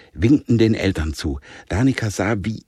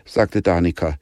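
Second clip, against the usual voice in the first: ordinary delivery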